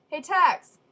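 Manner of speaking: loud call across the room. Real, not acted. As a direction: shout Tex across the classroom. Calling across the classroom.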